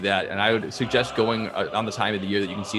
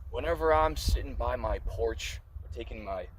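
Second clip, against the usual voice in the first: mock ad read; 3 semitones higher, 3 dB quieter than usual.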